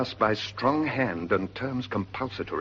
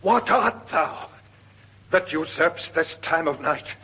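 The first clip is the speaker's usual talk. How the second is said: commanding challenge; 8 semitones higher, 3 dB louder than usual. Acted. Horatio loudly challenging the ghost to speak. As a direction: demand it answer you.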